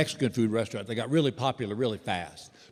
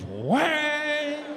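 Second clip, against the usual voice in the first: high ringing gong sound; gong imitation; 12 semitones higher than usual. Manager striking a gong, a high ringing whang.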